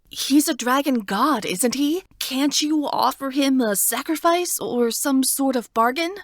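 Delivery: put-on anxious girl pleading; young girl voice. Heroine asks.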